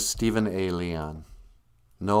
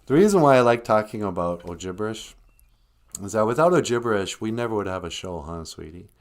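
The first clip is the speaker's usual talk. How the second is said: normal talking voice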